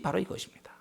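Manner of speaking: sudden hushed whisper to close the point. Real, not acted. Preacher to congregation, a whispered punchline. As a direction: drop to a whisper on the final words.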